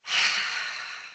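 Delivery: long breathy "ha" sigh letting the shoulders drop; audible relaxing exhale. Shown as a demonstration. Demonstrating the calming sigh to the audience, long breathy exhale. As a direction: release the tension in one slow out-breath. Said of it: "say ha and drop your shoulders".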